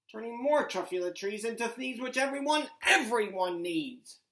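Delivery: loud, defiant finish; yelling Onceler. Acted. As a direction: slam the last words down. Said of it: "I yelled at the Lorax".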